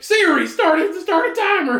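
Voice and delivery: high loud command to a phone; shouting at Siri. Pretend. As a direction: yell the order at the phone.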